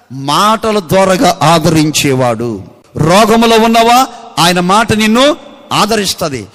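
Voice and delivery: loud, driving pulpit call; loud fervent sermon cry; preaching at full force. Acted. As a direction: proclaim it to the crowd.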